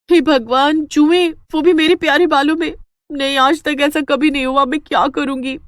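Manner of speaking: horrified at lice in her hair; horrified dismay. Acted. (घबराई हुई ऊँची आवाज़)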